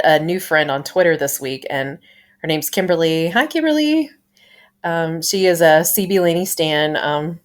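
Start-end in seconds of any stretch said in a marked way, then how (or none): 3.3-4.1 high-pitched sing-out hello; cutesy greeting. Real, not acted. Podcaster saying hi to a new Twitter friend, cutesy greeting. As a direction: chirp it to a friend listening in.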